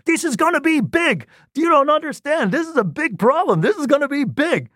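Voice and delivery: very high, thrilled outburst; excited. Retold or imitated. Professor hopping around the room over the result, very high and excited. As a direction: squeal it out like a jubilant professor.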